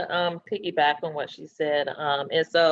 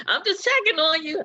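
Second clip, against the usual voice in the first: high, laughing and near tears; giggly, tearful check-in; laughing through tears; 12 semitones higher, 4 dB louder than usual. Real, not acted. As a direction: laugh the words out while choking up. Voicing friend, laughing tearfully.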